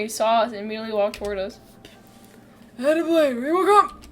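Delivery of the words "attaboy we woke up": hearty put-on father voice; louder, higher, hammed-up dad greeting; Artorius Diondal. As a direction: call out as the father character. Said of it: "Can't wait to do his voice".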